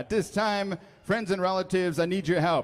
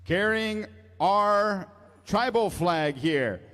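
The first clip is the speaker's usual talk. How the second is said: powwow emcee. Acted. Powwow emcee introducing honor guard.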